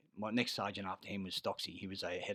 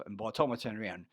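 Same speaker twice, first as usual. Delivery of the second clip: ordinary delivery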